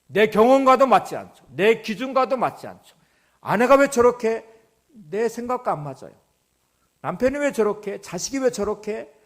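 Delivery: high, insistent pulpit delivery; impassioned preaching. Real, not acted. Preacher confronts the congregation about their anger, impassioned preaching.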